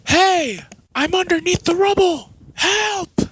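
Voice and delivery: very high, loud desperate yells; Naruto crying for help. Acted. Trapped Naruto begs the old warrior, high desperate yell. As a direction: cry out from under the rubble.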